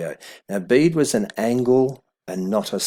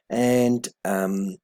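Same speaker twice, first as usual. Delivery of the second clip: ordinary delivery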